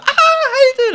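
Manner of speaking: very high mock squeal, half laughing; imitating screaming girls. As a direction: squeal a greeting like excited girls. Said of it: "they're all screaming".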